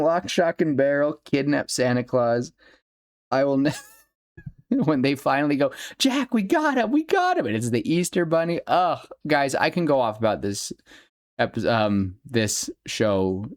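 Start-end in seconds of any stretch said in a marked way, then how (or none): 6.0-7.5 put-on high, squeaky kid voices shouting good news; gleeful squeaky report; excited kids. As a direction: crow it like proud little kids. Kids proudly report capture.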